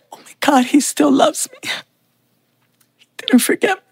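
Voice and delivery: high, cracking, near tears; fighting back tears as she speaks; voice breaking with emotion. Real, not acted. Retelling finding her lost father.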